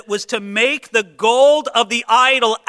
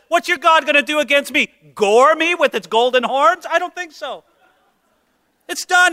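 higher, louder mocking challenge to the idol; Moses taunting the idol; 6 semitones higher, 4 dB louder than usual. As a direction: sneer down at their little god, daring it. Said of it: "As if he were saying".

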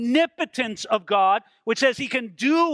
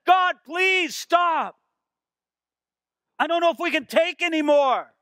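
high, loud begging cry; desperate plea; 9 semitones higher, 3 dB louder than usual. Acted. Preacher as Moses begging God to stop the trumpet, crying out desperately. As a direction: call up over the din, overwhelmed. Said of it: "Moses said".

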